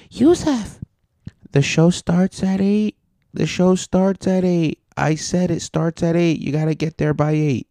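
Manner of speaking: high-pitched, insistent nagging imitation; mock a pestering friend in a high voice; nagging Carl. Carl hounding the comic about showtime, high mocking nag. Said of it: "fucking Carl was like".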